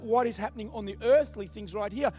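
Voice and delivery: preaching at full force. Acted. Preacher to his church, driving home the truth with full force. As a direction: hammer it home to the congregation. Preaching Jesus' words to Pilate.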